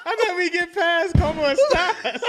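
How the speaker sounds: very high, loud, laughing disbelief; helpless laughing complaint. Real, not acted. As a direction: wail the complaint through laughter. Guest cracking up at failed Spanish.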